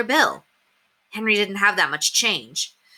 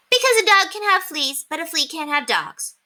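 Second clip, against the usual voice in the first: put-on much higher girlish sing-song; little girl voice; 11 semitones higher than usual. A narrator's reading. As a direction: deliver the punchline with glee. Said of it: "Ramona would answer".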